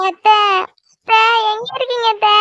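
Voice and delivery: high, wailing call for auntie; tearful calling. Acted. Girl comes looking for her aunt, crying as she calls. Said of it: "எதுக்கு இப்ப இப்படி அழுதுட்டு வர".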